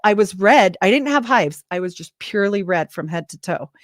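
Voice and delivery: ordinary talk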